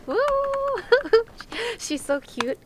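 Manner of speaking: ordinary delivery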